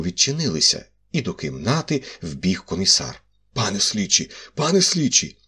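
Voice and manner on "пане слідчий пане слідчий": rising urgent call, the second much higher. Acted. Commissioner bursting in, calling the investigating magistrate urgently.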